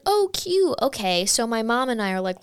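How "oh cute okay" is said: naive chirpy reaction. Retold or imitated. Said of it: "at first it's like".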